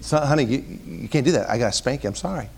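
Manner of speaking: low, soft apologetic dad; gentle regret. Retold or imitated. Father to crying daughter before a spanking, gentle regret. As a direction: let her down softly, re-enacting a reluctant parent.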